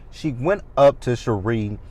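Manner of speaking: normal talking voice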